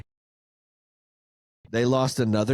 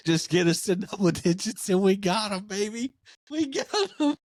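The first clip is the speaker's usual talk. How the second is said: rising, squeaky words through giggles; giddy laughing; 6 semitones higher than usual. Real, not acted. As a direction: climb into a high giggle by the end. Cracking up at own joke.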